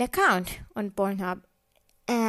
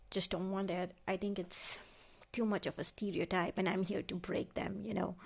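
drops to a near whisper, much softer; hushed confession; 10 dB quieter than usual. Real, not acted. Admitting her secret taste to the listeners in a hushed voice. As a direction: let it slip out under your breath, a touch sheepish.

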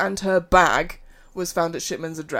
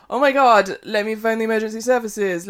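louder, higher, hammed-up fake emergency; mock alarm; 4 semitones higher, 7 dB louder than usual. Podcaster imitating the doctor's fake emergency call, in mock alarm. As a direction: put on a fake panic. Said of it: "Shipman made a fake call".

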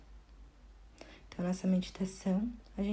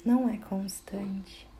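guided meditation; 9 semitones higher, 4 dB quieter than usual. Acted. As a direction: guide the listener gently inward, phrase by phrase. (meditação guiada, voz pausada e tranquila)

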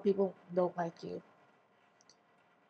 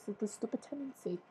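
ordinary delivery

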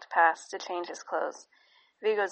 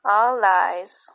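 put-on a baby's voice, louder and surer; the baby says it again, firmer; 2 semitones higher, 8 dB louder than usual. A narrator's reading. The baby repeats his words to his parents, louder. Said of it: "Then, a little louder".

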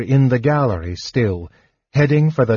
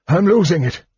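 higher-pitched alarmed cry; cried out; 7 semitones higher than usual. A narrator's reading. Old mage losing his spell, alarmed cry. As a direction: cry out as control slips. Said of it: "he cried".

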